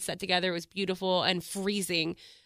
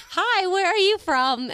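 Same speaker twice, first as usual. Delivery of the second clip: high, slow put-on old lady voice; 11 semitones higher, 6 dB louder, slower than usual. Girlfriend imitating a nosy tourist lady, high and slow. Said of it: "She's like".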